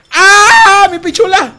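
loud, high scream easing into a whine; startled yelp. Real, not acted. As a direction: yell out as if suddenly hit. Cries out when suddenly hit. (grito agudo de susto)

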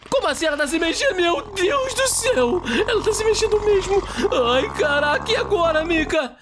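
high, frantic cries of alarm; a boy shrieks in high panic as the haunted house starts moving; panicked yelling. Acted. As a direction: scream for your friend to run as the house comes alive.

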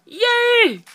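loud high squealed cheer; high-pitched burst of glee; gleeful cheer. Real, not acted. Cheers at the update.

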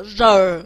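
r with the tongue slightly curled back; a drawn-out, lightly retroflex "r-uh"; 卷舌示范. Shown as a demonstration. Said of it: "可是有一点卷舌r". (舌头微卷，拉长念r)

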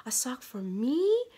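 imitating a small child's delighted question, pitch climbing high at the end; excited little son. Mom quoting her son about his new sock, excited rising voice. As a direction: voice shoots up on the final "me?", like an amazed preschooler. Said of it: "his little voice went up at".

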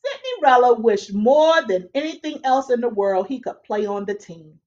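usual speaking voice

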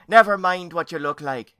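loud, high cutting-in scold; interrupting. A narrator's reading. Aunt interrupting her vain niece, scolding. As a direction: cut her off loudly. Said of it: "interrupted Lady Esther".

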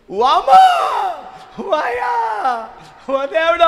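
high, loud mock wail to God; fake wailing. Thief wailing to the crowd, hammed-up high howl. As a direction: howl like a thief caught and putting on tears. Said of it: "దొర్లాడు ఏడుస్తున్నాడంట".

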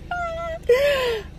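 high, loud laugh after a workout; worn-out laugh after leg day; breathless laugh. Real, not acted.

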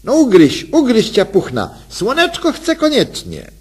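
high, loud wheedling coo; pestering wife. Acted. Wife keeps pestering silent husband, high wheedling coo. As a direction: nag him playfully, voice up high.

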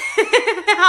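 short burst of laughter. Real, not acted. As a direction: crack up mid song. Breaks off laughing. (হাসিতে ফেটে পড়া)

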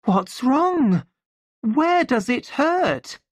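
very high, alarmed questions; panicked high voice; frightened cry. A narrator's reading. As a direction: cry out in fright, pitched well up. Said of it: "she cried".

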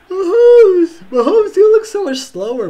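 loud, high wail at the player; excited outcry. Real, not acted. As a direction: wail it at the screen. Calling out at the quarterback.

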